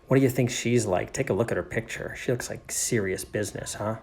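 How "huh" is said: ordinary delivery, nothing marked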